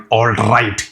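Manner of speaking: "all right" with a strongly rolled R; hard R. Shown as a demonstration. Teacher showing how not to say it, trilled "all right". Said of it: "you shouldn't say it like".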